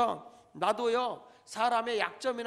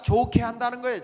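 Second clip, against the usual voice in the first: 좋게 한다는 거예요 proclaimed loud, with the punch on 좋게; emphatic; 4 semitones higher, 9 dB louder than usual. Shown as a demonstration. Pastor driving home the point, 좋게 한다는 거예요 called out loud. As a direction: belt out 좋게 한다는 거예요, hitting 좋게 hard. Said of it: "repeated for emphasis".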